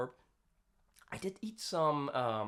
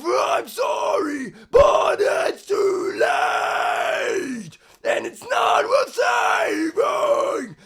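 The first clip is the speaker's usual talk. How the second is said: tight throat scream, higher; hyper compressed scream; 4 semitones higher than usual. Shown as a demonstration. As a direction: squeeze the scream hard from the throat. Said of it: "Let's do it hyper compressed".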